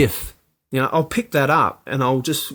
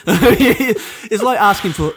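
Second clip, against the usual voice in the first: laughing; 8 semitones higher, 3 dB louder, much slower than usual. Real, not acted. Laughing mid-sentence.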